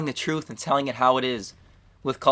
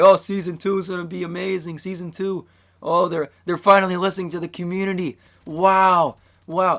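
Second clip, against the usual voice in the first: higher-pitched imitation of hyped fans; mock hopeful excitement; 4 semitones higher, 3 dB louder than usual. Imitating fans holding out hope for Season two, mock excited. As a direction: play a gushing, overhopeful fan in mockery. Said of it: "everyone was holding out hope for".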